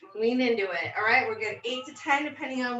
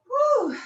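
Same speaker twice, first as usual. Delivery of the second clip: high whew of relief; relieved exhale; 12 semitones higher, 4 dB louder than usual. Real, not acted. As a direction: breathe out the effort. Recovering after set.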